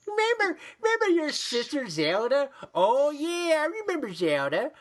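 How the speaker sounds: high, sing-along cartoon reminiscing; Family Guy parody. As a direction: ham up the "remember when" gag. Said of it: "just like Family Guy".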